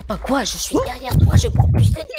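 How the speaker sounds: high, loud panicked yelling. Real, not acted. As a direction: shout it in a rising panic. Panicking under fire in game.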